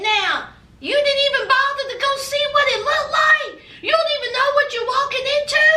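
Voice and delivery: incredulous scolding shout; mama scolding. Retold or imitated. June's mama scolding her son. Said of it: "Even June's mama said".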